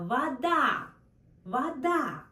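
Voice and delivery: Вода said vaDA, the O said as A, stress on the last syllable; Вода as vaDA, stressed at the end; Russian вода. Shown as a demonstration. Teacher models the unstressed O for learners. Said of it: "That's why sounds like A".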